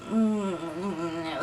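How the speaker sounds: low, quiet closed-mouth moaning. Retold or imitated. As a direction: moan faintly as if passed out. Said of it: "she just starts moaning".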